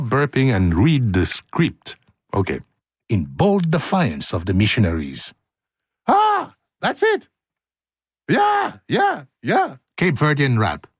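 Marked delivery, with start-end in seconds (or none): 6.1-9.8 squeaky, very high yelps; high squeals. Pretend. Host clowning between takes, high squealed yelps. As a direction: yelp it up in falsetto.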